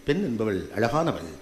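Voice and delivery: a hint of a pompous ad slogan; mock slogan. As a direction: intone the cliché with mock gravity. Mocking society's slogan.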